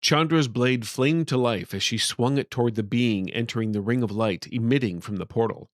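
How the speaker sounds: ordinary delivery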